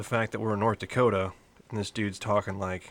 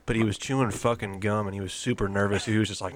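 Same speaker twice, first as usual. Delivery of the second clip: ordinary talk, nothing marked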